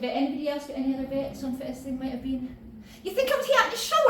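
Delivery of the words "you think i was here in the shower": mock teen. Mock teen asking audience.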